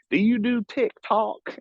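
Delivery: put-on high, drawn-out older lady's question; imitated older Michigan woman. Older women asking to follow him, imitated high and slow. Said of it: "the funniest thing is they were like".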